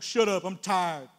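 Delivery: slow, irritable mock snap; a tired, cranky outburst; slow hammed-up grumpy retort.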